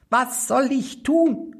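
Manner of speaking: eager small servant spirit; little manikin asking, slow. A narrator's reading. (hohe Koboldstimme, langsam fragend)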